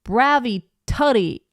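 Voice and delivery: "Bravi tutti" with a flapped, American "tutti"; drawn-out American take on "Bravi tutti"; American reading Italian. Retold or imitated. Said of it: "an American would say".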